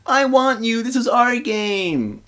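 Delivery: put-on high, slow childish wail; a jealous childhood friend freaking out, high childish wail. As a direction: wail it like a jealous little girl. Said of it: "starts freaking out".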